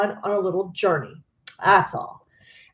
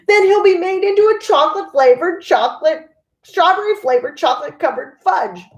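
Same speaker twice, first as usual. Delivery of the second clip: fast, much higher and louder tumbling scream; 9 semitones higher, 6 dB louder, faster than usual. A narrator's reading. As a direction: rattle off the flavors in a panicked scream. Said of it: "screamed Missus Gloop".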